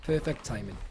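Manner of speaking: usual speaking voice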